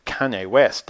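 plain reading voice

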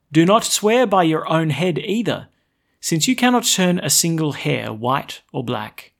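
loud, solemn scripture reading; gospel proclamation. Acted. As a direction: proclaim it to the whole church. Priest proclaims gospel aloud.

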